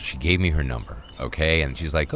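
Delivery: normal talking voice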